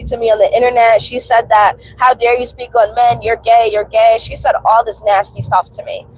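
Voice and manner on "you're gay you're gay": ordinary talk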